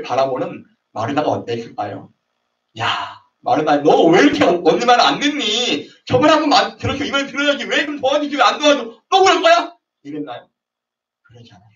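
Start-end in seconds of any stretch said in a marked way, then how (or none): 2.8-9.8 high, loud nagging big sister; scolding older sister. Acted. Older sister scolding Martha, high and shrill-voiced nagging. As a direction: nag your younger sister, rising in pitch.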